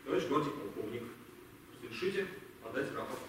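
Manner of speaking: low, quiet formal address; subdued formal request. Acted. Captain asking colonel to accept his report, low and subdued. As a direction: drop the voice low, stay formal.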